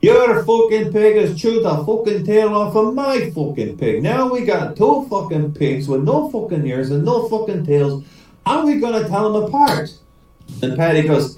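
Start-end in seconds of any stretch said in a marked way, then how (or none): 0.0-9.9 storming angry. Acted. Swearing Paddy storms in one last time, mock angry. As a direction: curse indignantly in an Irish brogue. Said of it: "the swearing Paddy, he storms into the house".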